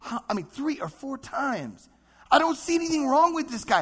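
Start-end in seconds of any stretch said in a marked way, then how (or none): 2.3-3.8 higher and louder, baffled; pleading Pilate. Retold or imitated. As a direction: protest like a puzzled governor. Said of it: "how many times did Pilate try".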